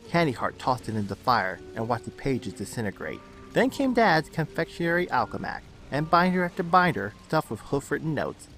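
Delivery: ordinary delivery, nothing marked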